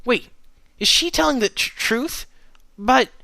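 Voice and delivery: high, confused, halting questions; flustered stuttering. A narrator's reading. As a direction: stammer in disbelief, pitch leaping up. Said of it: "stuttering in confusion, sounding extremely flustered".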